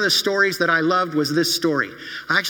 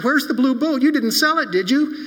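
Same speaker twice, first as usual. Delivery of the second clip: much higher and louder, frantic; panicked question; 8 semitones higher, 4 dB louder than usual. Acted. Billy bursting into the store afraid the boat is sold, frantic. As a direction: burst in fearing the worst.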